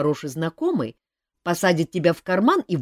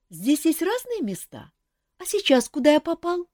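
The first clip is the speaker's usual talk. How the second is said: high small bird asking; wide-eyed little chick; curious chick voice; 13 semitones higher than usual. A narrator's reading. Chick asks Kantor about the maze, high curious.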